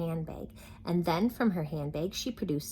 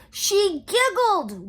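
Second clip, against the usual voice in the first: much higher, loud, slow wail; anguished wail; 14 semitones higher, 10 dB louder, much slower than usual. Acted. King wailing about Sunshine's giggle. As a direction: howl it in despair. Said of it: "went on the king".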